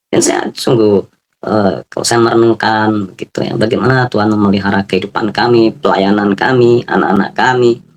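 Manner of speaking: usual speaking voice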